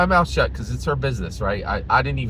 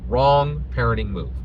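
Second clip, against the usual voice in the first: ordinary talk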